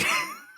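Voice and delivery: sudden very high squealing laugh. Real, not acted. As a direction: burst into a squeaky giggle. Embarrassed after crude joke.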